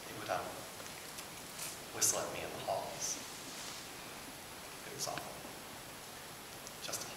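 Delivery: hushed, halting and low; pained recollection. Acted. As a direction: let the painful memory quieten you. Painful memory of school bullying.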